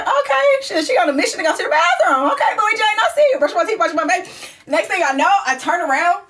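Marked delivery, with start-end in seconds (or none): 0.0-4.3 high, fast startled thoughts; sudden high alarmed muttering; startled inner voice. Retold or imitated. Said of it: "I'm like".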